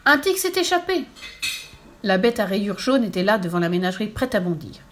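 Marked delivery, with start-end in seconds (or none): 0.0-1.0 high, loud cry of alarm; acted panic, pitched way up; terrified cry. A narrator's reading. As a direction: cry out a warning to the crowd. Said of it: "ce cri terrifiant".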